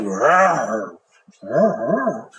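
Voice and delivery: playful big bad wolf roar. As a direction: roar at the little girl, storybook style. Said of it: "the big bad wolf said".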